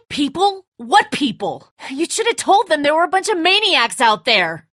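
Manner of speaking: high, loud accusing yell; angry shout. Acted. Father berating Lee for hiding the danger, angry yell. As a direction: hurl the blame at them. Furious at being kept uninformed.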